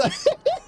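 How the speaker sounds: high rising giggle; a quick high giggle; squealing laugh. Real, not acted. Caster seeing her try again, high rising giggle.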